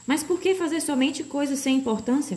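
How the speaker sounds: puzzled questioning. A narrator's reading. Milo questions the faceless man.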